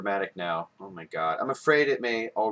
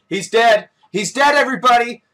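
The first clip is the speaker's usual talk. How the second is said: much higher, louder announcing; excited shout; 11 semitones higher, 10 dB louder than usual. Real, not acted. Gamer announcing the boss kill to everybody, high loud shout. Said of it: "announces the kill".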